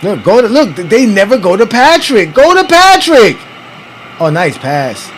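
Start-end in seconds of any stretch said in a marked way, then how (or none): 2.3-3.4 exasperated plea. Real, not acted. Pleading at the TV.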